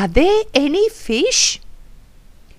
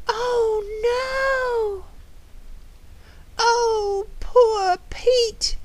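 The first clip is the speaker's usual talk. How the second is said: high, drawn-out wail; distressed pity; 12 semitones higher, 4 dB quieter, much slower than usual. Acted. Lamenting the hurt parrot, distressed pity.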